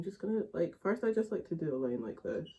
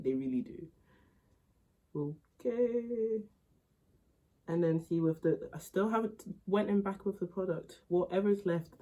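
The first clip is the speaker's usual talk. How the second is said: ordinary delivery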